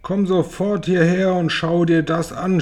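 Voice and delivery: hoch und laut gerufen; Schrei. A narrator's reading. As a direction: ruft aufgeregt hinüber. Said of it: "schrie Tante Zinke". (hoher, gespielter Schrei)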